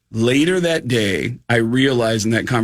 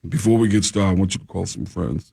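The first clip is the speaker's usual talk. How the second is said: Shaq impression; 5 semitones lower than usual. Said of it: "he's like".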